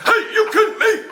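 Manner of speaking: high, loud laughing hey, you kidding me; mock ref complaint. Coach retelling his bench complaint to the ref, high and laughing. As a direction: shout it at the ref half laughing. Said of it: "Like, I, I do that".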